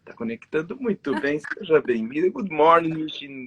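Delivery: loud, bright hello across the world; excited greeting. Real, not acted. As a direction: call out the welcome loud and high. Greeting co-host joining live.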